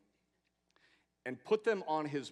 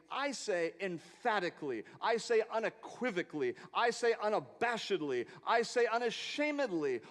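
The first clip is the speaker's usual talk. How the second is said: building list, louder with each line; preaching crescendo; 6 semitones higher, 4 dB louder than usual. Acted. Preacher to the congregation, rising to a full preaching shout. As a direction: pile it on like a revival preacher.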